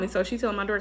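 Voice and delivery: ordinary delivery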